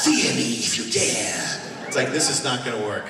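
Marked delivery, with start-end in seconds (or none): 0.0-1.6 slow, loud hammed-up Spanish-accented swashbuckler; Puss in Boots Spanish accent. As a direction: boom it out like a cocky cat hero with a Spanish accent. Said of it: "it sounded like Puss in Boots".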